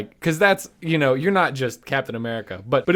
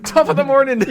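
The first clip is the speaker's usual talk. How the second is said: high, loud mock Irish greeting; Jacksepticeye; 12 semitones higher, 8 dB louder than usual. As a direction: shout it like an excitable Irish YouTuber. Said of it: "the Jacksepticeye thing".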